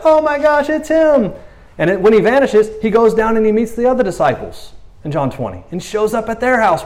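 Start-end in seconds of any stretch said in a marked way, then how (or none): 0.0-1.3 high gasp of shock; they suddenly see it's him, much higher; shocked realization. Pretend. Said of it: "They're like".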